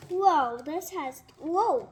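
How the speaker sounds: loud, slow amazed whoa. Real, not acted. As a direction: gasp in wonder. Amazed at new toy.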